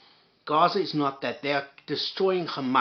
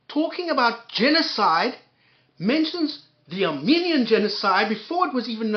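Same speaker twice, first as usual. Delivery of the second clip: higher, louder incredulous outrage; outraged rant; 7 semitones higher, 5 dB louder than usual. Real, not acted. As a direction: rail at him in disbelief. Ranting at journalist in disbelief.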